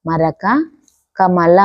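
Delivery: each word said slowly and clearly, with a pause after it; slow word dictation. Shown as a demonstration. Teacher reading words for children to repeat, slow clear dictation.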